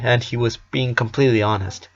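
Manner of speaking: plain reading voice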